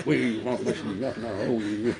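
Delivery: slow, laughing mock plea; laughing mockery. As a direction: drawl it out while laughing. Mock timid prayer, laughing. (imitation moqueuse en riant)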